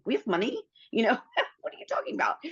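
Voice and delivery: high, fast and laughing, as if it's absurd; you can't be serious, laughing it off; incredulous laughing disbelief. Retold or imitated. Wife asks husband why steal when they have money, laughing in disbelief.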